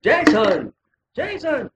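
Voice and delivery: calling out. Real, not acted. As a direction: call the name out high across the room. Calling out a friend's name.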